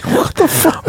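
usual speaking voice

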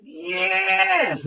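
high, loud joyful whoop; excited yell. Retold or imitated. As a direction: shout it out like seeing old mates. Excited greeting to old mates.

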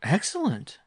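high-pitched mock exclamation; borrowed exclamation. A narrator's reading. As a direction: exclaim it as someone else's catchword. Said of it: "using one of her husband's exclamations".

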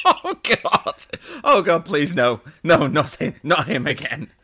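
loud, high, laughing pleading; mock despair. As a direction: beg in hammed-up horror. Joking horror at the dub.

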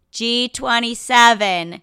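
loud, slow caller's shout; loud bingo call. Retold or imitated. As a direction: call the number out to the hall. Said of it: "and she says".

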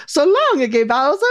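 high, mock Italian catchphrase; Italian accent. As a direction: put on a cartoon Italian accent. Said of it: "to do an Italian accent".

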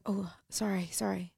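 whispered, breathless sorry; soft whispered sorry to a stranger; hushed apology. Retold or imitated. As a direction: whisper it like a father caught off guard and ashamed. Grieving dad apologizes on train.

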